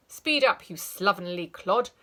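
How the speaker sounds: loud, barking rebuke; teacher blocking a runner; stern scold. Acted. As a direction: bark at the runner.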